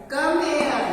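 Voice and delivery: imitating ma'am calling a pupil over. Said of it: "ma'am क्या कहती है बेटा".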